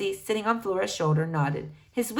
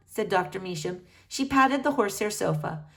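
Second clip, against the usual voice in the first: usual speaking voice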